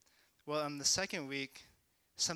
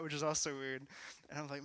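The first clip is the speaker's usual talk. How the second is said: ordinary talk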